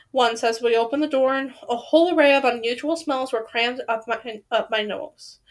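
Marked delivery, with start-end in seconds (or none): 4.5-5.4 "nose" said oddly, with a slip in it; odd pronunciation. Real, not acted. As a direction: stumble on the word "nose". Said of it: "Why did I say nose like that?".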